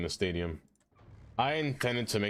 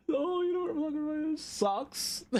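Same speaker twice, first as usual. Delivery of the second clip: squealing laugh. Real, not acted. Laughing at chat name.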